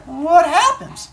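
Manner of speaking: put-on high, slow innocent kid voice; goofy kid. Teacher voices Ricardo asking his penalty, high slow kid. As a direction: ask it like a clueless child. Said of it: "And he's like".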